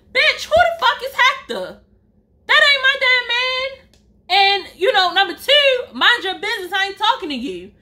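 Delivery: high-pitched, sassy telling-off; indignant comeback. Retold or imitated. Storyteller putting coworker in place, high indignant retort.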